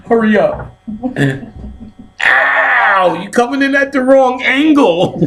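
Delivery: loud, very high-pitched pained complaint; hammed-up yelping in a high voice; mock pain. Man complaining to his partner mid-act, high pained yelp. Acted pain in bedroom scene.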